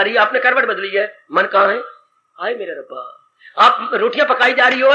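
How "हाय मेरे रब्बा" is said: mock lament. Distracted woman's lament.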